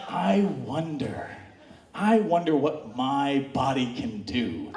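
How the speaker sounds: low, slow musing; drawn-out, deep-pitched wondering. Retold or imitated. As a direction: ponder it slowly and low. Acting own awed curiosity.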